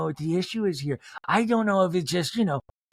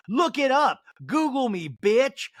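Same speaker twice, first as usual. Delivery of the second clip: higher-pitched mock tough talk; hammed-up gangster; 8 semitones higher, 3 dB louder than usual. [name] defending herself at dinner, hammed-up gangster. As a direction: throw down an imitated challenge. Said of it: "her saying all this does really sound gangster".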